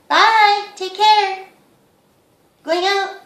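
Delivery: high, loud calling-out farewell; sing-song goodbye. Real, not acted. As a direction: sing a bright goodbye to the bird. Calling bye to the parrot.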